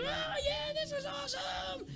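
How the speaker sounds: very high, slow, fake-thrilled voice; mocking excited kids. Mocking the kids who pretend the ride is fun, in a very high gushing voice. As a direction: squeal it like a giddy kid pretending to love it. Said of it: "these boys and girls are like".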